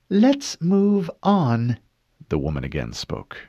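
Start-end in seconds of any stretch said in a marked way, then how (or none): 0.1-1.8 high, slow clipped impatience; irritated. Acted. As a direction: cut him off, annoyed. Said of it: "her voice now laced with irritation".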